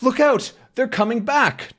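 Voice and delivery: high, urgent warning cry; alarmed warning. Acted. Man warning Tintin to distract him, high alarmed cry. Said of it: "And the man goes".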